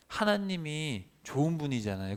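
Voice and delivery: ordinary talk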